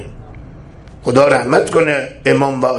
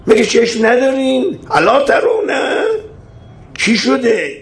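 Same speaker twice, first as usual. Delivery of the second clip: sharply higher, pleading questions to the crowd; high rhetorical outcry; 9 semitones higher than usual. Acted. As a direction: cry out to wake the listeners.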